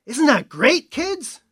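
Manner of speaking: high, loud, delighted exclamation; beaming mentor showing the students the birds; enthusiastic teacher. Retold or imitated. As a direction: gush at the kids like a proud guide. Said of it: "and he'd go".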